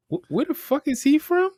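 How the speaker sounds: high-pitched, acted-out bewilderment; baffled disbelief. Husband and wife reacting to the father's accent, acted-out bafflement. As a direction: puzzle it out aloud in mock disbelief, pitched well up. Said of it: "looked at each other like".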